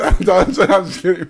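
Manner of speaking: normal talking voice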